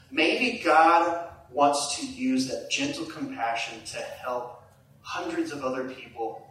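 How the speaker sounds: ordinary talk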